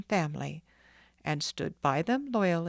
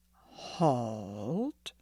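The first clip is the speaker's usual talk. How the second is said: stern command; 6 semitones lower than usual. Acted. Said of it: "cried Doctor Meigs sternly".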